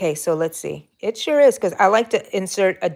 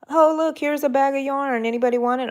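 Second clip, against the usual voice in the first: higher, louder breezy offer; imitating a donor; 8 semitones higher, 4 dB louder than usual. Crocheter imitates someone casually offering free yarn, lightly voiced. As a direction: toss it off like giving away junk. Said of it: "It's just".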